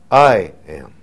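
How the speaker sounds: the preacher shows the Greek emphasis to his listeners, stressing "I". Shown as a demonstration. Hit the I. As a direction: lean hard on "I" in "I am.". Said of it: "the emphasis is on the I".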